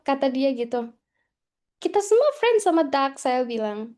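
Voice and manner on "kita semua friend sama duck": higher, louder mock insistence; insistent retort. Student argues back to housemate, insistent retold retort. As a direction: act out arguing back, pitched up.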